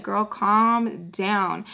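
very slow, drawn-out words; self-talk. Retold or imitated. She talks herself down when irked, slowly. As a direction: stretch it out to settle yourself. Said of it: "kept trying to talk to myself".

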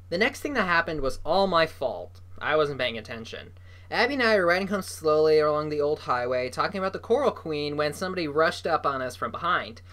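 usual speaking voice

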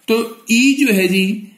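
ई stretched long and slow; drawn-out vowel. Shown as a demonstration. Teacher shows the vowel sound to students, drawn out. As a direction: hold the ई sound out. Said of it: "आप इस तरीके से लिखते हैं".

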